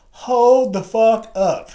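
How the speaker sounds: put-on loud, high, slow Southern-accented disbelief; mock Southern instructor's astonished call; strong Southern accent. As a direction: halt everything in a drawn-out Southern voice. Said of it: "strong Southern accent or whatever".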